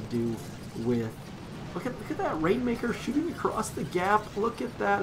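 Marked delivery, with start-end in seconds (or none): 1.7-5.0 high-pitched real excitement; excited gamer calling attention to a shot; excited pointing-out. Real, not acted. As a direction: marvel at it, pitch jumping up. Points out surprising long-range shot in game.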